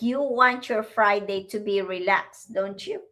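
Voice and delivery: ordinary delivery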